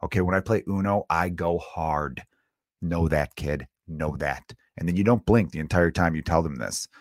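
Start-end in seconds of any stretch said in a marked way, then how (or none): 1.1-4.5 put-on low, slow, dead-serious threat to the kid; mock tough warning. Dad warning his kid about Uno, mock tough and slow. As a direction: stare them down and promise you play hard.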